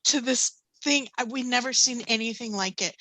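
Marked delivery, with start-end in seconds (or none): none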